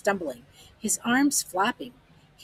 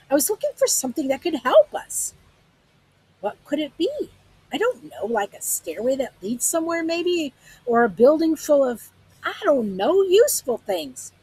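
lightly voiced shaken man, pitched up; rattled, raised answer; 7 semitones higher, 5 dB louder than usual. A narrator's reading. Sag answering Lena's question, raised and unsettled. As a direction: grasp for words, half frantic.

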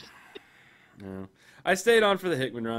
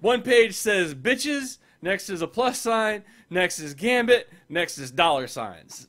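mock boardroom pitch; 6 semitones higher, 4 dB louder, slower than usual. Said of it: "It's like".

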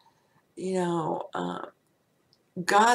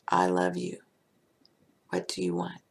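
deep divine voice. Pretend. God's voice, far lower.

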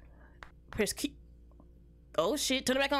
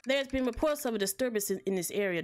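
ordinary talk, nothing marked

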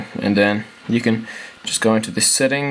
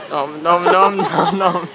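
nom nom chomping; 6 semitones higher, slower than usual. Pretend. Playful chomping noises at cat.

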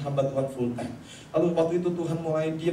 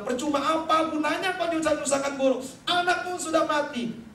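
raised, urgent messenger voice; messenger's outcry; 10 semitones higher than usual. Acted. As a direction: cry it out like a bearer of bad news. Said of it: "ada orang bilang".

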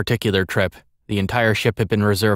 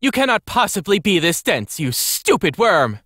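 high, scornful scolding, slowing on the insult; angry snap; 11 semitones higher than usual. A narrator's reading. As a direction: snap at a hopeless servant. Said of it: "she snapped".

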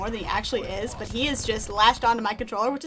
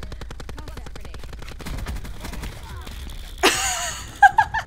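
long high burst of laughter; long high giggle fit. Real, not acted. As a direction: crack up helplessly. Snake spinning the game.

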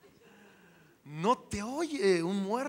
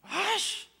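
scornful crowd; 8 semitones higher, slower than usual. Retold or imitated. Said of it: "Imagínense todos".

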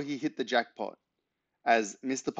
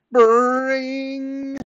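very high, loud, held doorbell imitation; insistent doorbell ring; 8 dB louder than usual. A narrator's reading. Neighbour leaves his finger on buzzer, insistent high ringing. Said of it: "This ring was more insistent".

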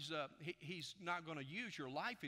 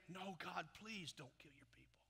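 hushed plea sinking to a whisper; acted intercession, fading into whisper; pleading Moses; 3 semitones higher, 6 dB quieter than usual. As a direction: plead under your breath for mercy. Voices Moses pleading with God.

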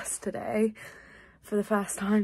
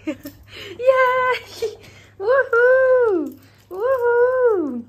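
much higher, much louder gleeful yay; excited cheering; 13 semitones higher, 11 dB louder, much slower than usual. Real, not acted. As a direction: cheer like you just won for the dog. Celebrating with the dog.

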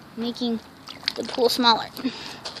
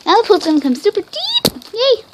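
high cheering squeal; excited; 9 semitones higher, 4 dB louder than usual. Real, not acted. Pool filling, cheering.

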